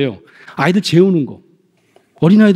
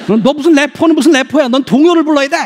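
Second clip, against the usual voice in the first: scolding parent; 6 semitones higher than usual. Acted. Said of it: "근데 안 돼".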